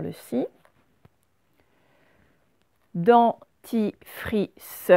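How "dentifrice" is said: DENTIFRICE very slowly as DEN-TI-FRI-CE; teacher pausing after each syllable of DENTIFRICE; split into syllables. Shown as a demonstration. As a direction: break DENTIFRICE apart, final SE sounded. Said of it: "c'est la dernière syllabe".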